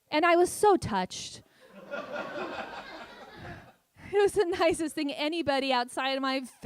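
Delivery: laughing through the words. Real, not acted. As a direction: giggle out the punchline. Ironic touched punchline.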